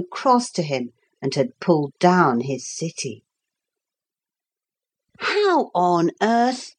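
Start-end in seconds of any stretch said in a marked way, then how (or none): 5.2-6.7 wondering. Acted. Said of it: "Philip wondered to himself".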